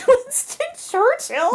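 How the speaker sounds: high, drawn-out laughing question; laughing disbelief. Real, not acted. Reacting to the co-host's suggestion, laughing disbelief. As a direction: echo the name through real laughter.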